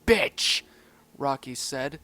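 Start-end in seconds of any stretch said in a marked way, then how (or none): 0.0-0.6 slow, high, bursting loud on bitch; a pup cursing his friend; rage. Acted. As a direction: spit the insult in rage. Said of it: "his eyes filled with utter rage".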